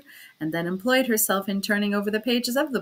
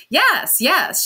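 much higher and louder, eager cry; 8 semitones higher, 10 dB louder than usual. Acted. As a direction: burst out eagerly, almost jumping up. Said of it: "she cried eagerly".

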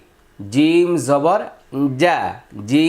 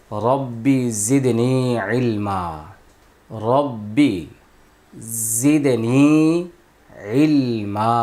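slow recited prayer; 2 semitones lower, much slower than usual. Acted. Teacher recites opening dua.

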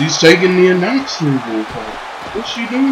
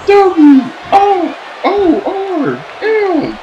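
drawn-out hype spelling; 12 semitones higher, much slower than usual. Acted. Spelling out the wrestler's name.

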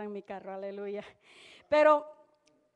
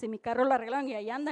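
ordinary talk, nothing marked

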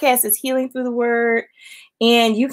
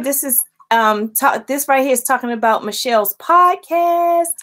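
ordinary talk

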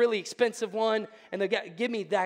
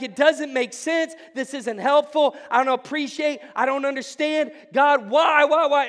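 higher and louder, exasperated pleading to God; frustrated cry; 6 semitones higher, 9 dB louder than usual. Retold or imitated. A struggling believer crying out to God in frustration. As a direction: vent it like everything is spinning out of control.

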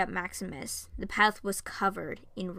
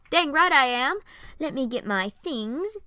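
higher, louder cocky brag; gloating; 5 semitones higher, 5 dB louder than usual. Acted. A man gloating after the friend's forced praise, cocky. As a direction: crow about it, full of himself.

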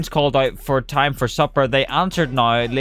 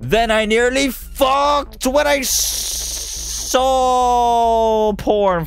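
hammed-up reading; 8 semitones higher than usual.

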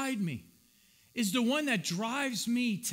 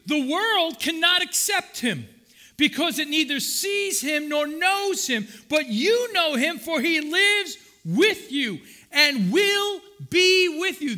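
loud, high preaching of scripture; 6 semitones higher, 7 dB louder than usual. Acted. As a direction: proclaim the verse with force. Preaching scripture at full force.

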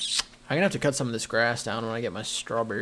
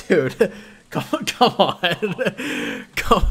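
laughing; 6 semitones higher, 5 dB louder, much slower than usual. Real, not acted. Laughing at friend's fishing.

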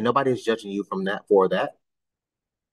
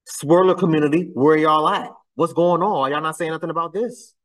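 raised, louder summoning voice; calling out; 5 semitones higher, 3 dB louder than usual. Real, not acted. Challenging the swirler community to speak up, calling out. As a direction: call for answers from a silent crowd.

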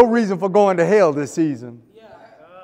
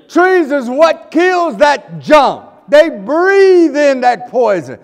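loud, slow, higher shout; slow preaching shout; 6 semitones higher, 7 dB louder, slower than usual. Real, not acted. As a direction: pound each word out.

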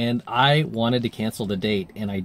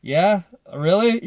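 self-conscious reply; 8 semitones higher, 4 dB louder, slower than usual. Shown as a demonstration. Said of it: "I'd kinda just do this".

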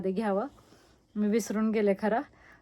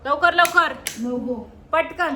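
loud, higher hurry-up call; 5 semitones higher, 4 dB louder, slower than usual. Real, not acted. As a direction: rush them out, loud. Hurrying kids out the door. (मुलांना घाईने मोठ्याने बोलावणे)

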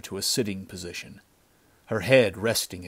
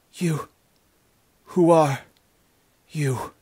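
high, halting gasps between words; wounded girl gasping out a question; 8 semitones higher, 5 dB louder than usual. A narrator's reading. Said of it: "Her words came in gasps".

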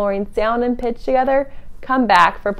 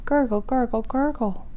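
softer gurgles, fading; gurgling water; 2 semitones higher, 9 dB quieter than usual. Acted. Voicing moving water gurgling softly for kids. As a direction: gurgle quietly like a stream.

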